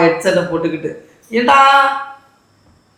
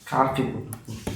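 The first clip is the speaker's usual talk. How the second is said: much softer and lower, slow, words kept inside the mouth; barely voiced mumble; 9 semitones lower, 14 dB quieter, slower than usual. Husband pranks his wife by faking a lost voice, near-silent mumble. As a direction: mouth it almost silently, as if your voice is gone. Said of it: "ஏன் வாய்க்குள்ளேயே பேசுற?".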